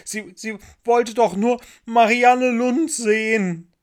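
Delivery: high, loud, slow wail; crying child protesting; sobbing. A narrator's reading. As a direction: wail it between acted sobs. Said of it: "schluchzte er".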